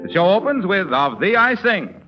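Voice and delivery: ordinary talk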